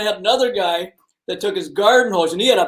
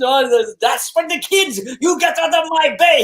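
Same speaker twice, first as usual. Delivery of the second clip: imitating a shouted German accent, higher and angry; mock the German cottager yelling in his accent; 7 semitones higher than usual. Fisherman mimics German cottage owner chasing anglers off: shouted German accent. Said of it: "He had that friggin' accent going".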